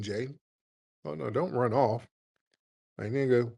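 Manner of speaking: normal talking voice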